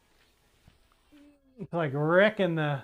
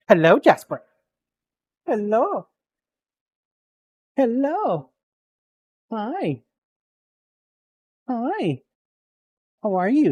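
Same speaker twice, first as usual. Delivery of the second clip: high, sing-song dog greeting; talking to a dog; 3 semitones higher than usual. Real, not acted. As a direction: coo at the dog like a pet.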